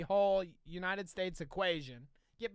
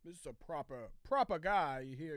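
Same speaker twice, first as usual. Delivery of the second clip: lightly imitated British accent, lower; 5 semitones lower, 4 dB quieter than usual. American mimicking educated Brits, hint of British accent. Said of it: "British accents that seem like they're of higher education".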